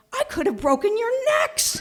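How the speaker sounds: high, loud, frightened outcry; frantic dad. Retold or imitated. Dad hugs his rescued kids, loud frightened outcry. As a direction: cry out in shaken relief.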